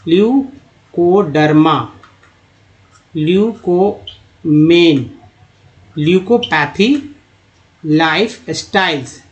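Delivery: drawn-out syllables with breaks inside words; slow word modelling. Shown as a demonstration. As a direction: read each term slowly, part by part. Teacher models terms part by part.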